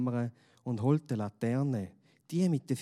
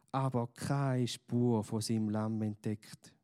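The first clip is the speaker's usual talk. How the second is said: usual speaking voice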